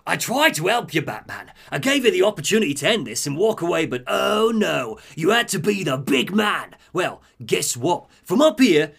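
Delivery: high, gloating sneer; gloating Penguin. Retold or imitated. Penguin gloats down at Batman in the pit, high sneer. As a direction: gloat down at him from above. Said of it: "he's like".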